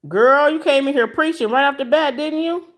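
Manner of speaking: high, loud playful call-out; playful teasing. Real, not acted. Teasing a viewer who came in preaching, loud and playful. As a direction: tease her for preaching right away.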